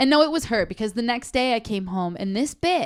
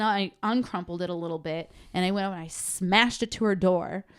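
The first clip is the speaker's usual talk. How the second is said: normal talking voice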